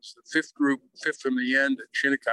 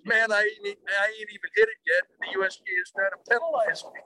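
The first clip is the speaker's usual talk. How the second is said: higher-pitched mock complaint; retold protest; 6 semitones higher than usual. Golfer to starter who spoke too soon, protesting. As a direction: imitate a put-upon golfer protesting.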